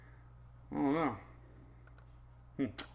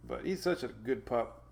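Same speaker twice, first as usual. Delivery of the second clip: normal talking voice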